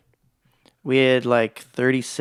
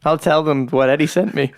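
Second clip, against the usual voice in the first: higher and louder hammed-up vow; mock dramatic threat; 4 semitones higher, 4 dB louder than usual. Man jokingly threatens his friend with a hammed-up dramatic vow. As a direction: play it as a mock last stand. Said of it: "I was like".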